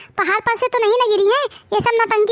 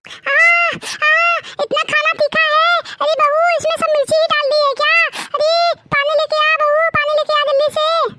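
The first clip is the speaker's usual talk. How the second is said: put-on old mother-in-law shouting for water after spicy food; old woman burning mouth; 6 semitones higher than usual. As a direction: yelp at the chilli and call for water.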